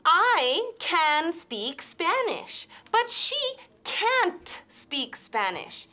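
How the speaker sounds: can't with a hard, crisp T, slow; strong T in can't. Shown as a demonstration. Teacher drilling the learner, hard T in can't. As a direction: spit the T of can't. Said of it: "con esa T fuerte".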